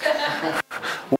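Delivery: a short, very high breathy laugh; high mock laugh. Preacher mocking a keen volunteer with a high laugh. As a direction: giggle high and airy, pleased with yourself.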